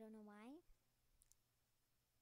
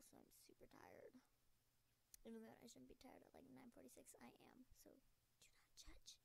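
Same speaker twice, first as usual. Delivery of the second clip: whispering; 2 semitones higher, 5 dB quieter than usual. Real, not acted. Tired gamer whispering at night.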